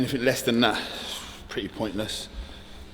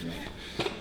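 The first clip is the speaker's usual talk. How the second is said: ordinary delivery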